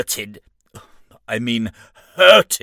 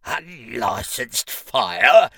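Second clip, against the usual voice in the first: disbelieving; 2 semitones higher, 3 dB louder, much slower than usual. Acted. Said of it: "looked at the diminutive figure in disbelief".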